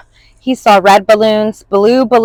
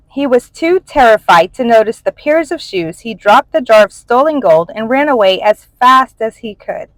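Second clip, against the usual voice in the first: plain reading voice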